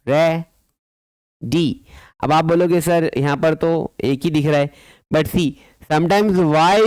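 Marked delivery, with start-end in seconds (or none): 0.1-1.7 ready pulled apart: re, then dee; long silence inside ready; broken into syllables. Shown as a demonstration. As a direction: dictate ready in two separate beats.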